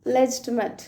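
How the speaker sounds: లెజిటిమేట్ ending in schwa, "-muht"; లెజిటిమేట్ with a light "uh" last syllable; American schwa variant. Shown as a demonstration. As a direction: weaken the end of లెజిటిమేట్ to "-muht". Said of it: "light శ్వా sound".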